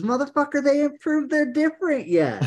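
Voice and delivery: high, scornful rant; mocking exasperation. Real, not acted. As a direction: sneer at the headline in a high voice. Mocking Leafs media headline.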